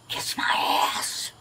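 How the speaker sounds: drawn-out whispered mock jeer; imitated taunt. Resident mimicking the girls' insult to an officer, whispered taunt. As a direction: mimic their taunt in a long, slow whisper. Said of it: "stick their ass up at me and go".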